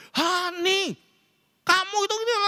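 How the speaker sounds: very high mock-exasperated mum; scolding mother. Mother scolding her naughty son, high mock exasperation. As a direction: imitate mum's high-pitched telling-off.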